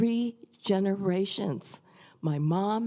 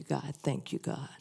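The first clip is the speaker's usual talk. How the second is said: softer and softer, ending in a hushed whisper; fading to a whisper; 3 semitones lower, 7 dB quieter than usual. Shown as a demonstration. Speaker thanking God, trailing off into a whisper. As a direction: let the thanks sink into a whispered prayer. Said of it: "the really important one".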